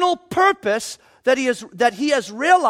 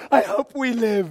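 mock huddled fear. Huddled under blankets in storm.

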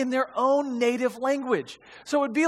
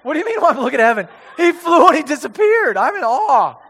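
fast, loud laughing disbelief; amazed disciple; 3 semitones higher, 8 dB louder than usual. Pretend. Preacher acting a stunned disciple, loud and fast.

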